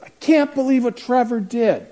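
high, loud, slow panicked plea; a frantic mother calling for help; panicked mom. Retold or imitated. Mother on the phone to the counselor, panicked and loud. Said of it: "his mom calls me two days later in a panic".

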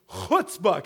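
Chutzpah with a throaty, spitting ch at the start; guttural cha. Shown as a demonstration. Preacher teaches the congregation a Yiddish word, guttural Chutzpah. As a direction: rasp the first sound of Chutzpah in the throat. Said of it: "You gotta get the guttural".

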